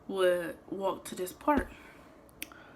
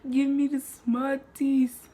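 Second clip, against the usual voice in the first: stern store owner; 8 semitones higher, 9 dB louder, much slower than usual. Retold or imitated. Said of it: "He was like".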